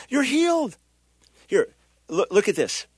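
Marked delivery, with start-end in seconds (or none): 0.1-0.8 high-pitched acted delight; amazed rejoicing. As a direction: marvel at it, pitch jumping up. Imagined joyful reaction to a healing.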